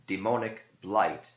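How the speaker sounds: plain reading voice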